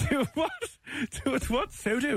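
high, through laughter; high laughing Sodo. Real, not acted. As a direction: crack up while repeating it. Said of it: "I'm gonna fall off the chair".

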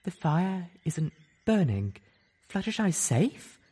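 high, slow, hopeful disbelief; daring to hope; fragile hope. Acted. Unicorn asks if her friend is safe, hopeful. Velvet hoping.

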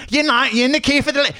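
very high-pitched, garbled mock British accent; imitated British accent. Frustrated stranger asking about the queue, hammy British accent. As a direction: squawk it in exaggerated British. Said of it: "also stop yelling".

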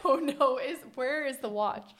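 high, loud, laughing "oh no"; mock panic. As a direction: play a half-laughing panic over a lost watch. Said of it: "it's a very weird feeling when you're like".

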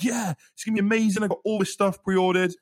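hyped-up crowd impression; hyped fans. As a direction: cheer it out like everyone's thrilled. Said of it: "people, like, just being hyped".

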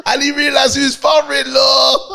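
shouting through laughter; high laughing yell. Real, not acted.

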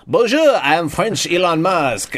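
mock French accent, high and loud. Tom Cruise leaning out to taunt Musk in mock French. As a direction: greet him in a hammed-up French accent. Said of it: "Tom Cruise leans out and he's like".

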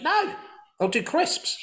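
high mocking coworker voice. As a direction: squeak it up like the office lads. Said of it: "they're like".

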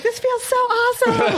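very high-pitched imitated thrill; retold squeal of excitement; excited daughter. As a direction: mimic an overjoyed daughter, voice shooting way up. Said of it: "so excited".

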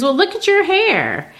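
high, playful retort; Asagai teasing. Retold or imitated. Asagai pointing at Beneatha's hair, high teasing voice. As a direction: poke fun at her, high and light. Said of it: "And he goes".

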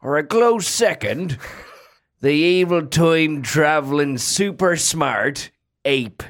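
put-on a light Yorkshire accent, unhurried. A podcast host asked for Yorkshire names his favourite apes, in a light Yorkshire accent. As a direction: talk about apes like a Yorkshire local, lightly done. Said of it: "What about a Yorkshire?".

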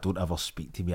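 low, hushed, breathy threat; quoting the threat. Retold or imitated. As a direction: murmur it coldly, under the breath. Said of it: "I love that".

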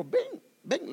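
high, ringing call across the water; calling out "come". Acted. Jesus calling Peter to step out, high call. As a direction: call him out of the boat, high and bright.

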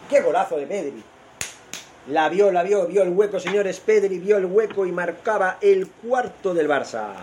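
high, thrilled goal commentary; excited goal commentary. Real, not acted. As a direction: ride the joy of the goal.

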